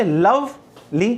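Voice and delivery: slow "lovely" with a gap after लव; "lovely" in two separate beats; syllable by syllable. Shown as a demonstration. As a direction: say लव, wait, then ली for "lovely". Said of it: "ऐसे तो बोलोगे".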